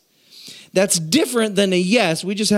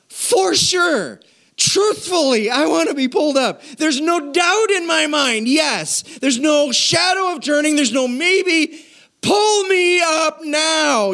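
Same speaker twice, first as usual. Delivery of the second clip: emphatic pleading; 10 semitones higher, slower than usual. Retold or imitated. Desperate man hanging from cliff.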